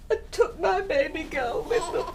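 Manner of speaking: tearful break. Acted. Mother mourns daughter leaving.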